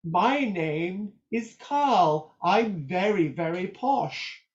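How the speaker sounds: slow, drawn-out plummy English voice; imitating a posh southerner, every word pronounced perfectly. A southerner introducing himself, in a mock posh accent. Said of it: "somebody says".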